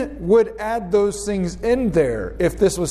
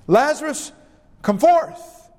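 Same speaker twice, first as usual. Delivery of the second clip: high-pitched calling voice, restrained in volume; crying out; 9 semitones higher than usual. Retold or imitated. Jesus calling Lazarus out of the tomb, crying out. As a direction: call it out high, lifting at the end. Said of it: "he cried out with a loud voice".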